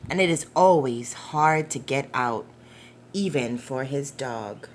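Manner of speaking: gentle storybook narration, unhurried; reading to children. Acted. Reading The Paper Boy aloud to young listeners, gentle storytelling. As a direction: read it like a bedtime picture book. Reads picture book aloud.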